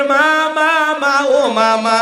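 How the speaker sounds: high, drawn-out singsong call of মা; calling mother. Retold or imitated. Preacher acting a son calling his mother. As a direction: whine for mother like a needy son. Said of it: "মারে যদি ডাক দেন".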